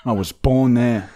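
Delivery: normal talking voice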